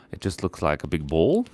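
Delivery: normal talking voice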